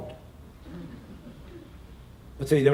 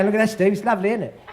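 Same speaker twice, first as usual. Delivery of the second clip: mock Manc local; 6 semitones higher, 3 dB louder, faster than usual. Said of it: "You go".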